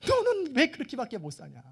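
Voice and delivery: mock-scold in exasperation; acted scolding. Moses catching someone dumping manna, scolding him.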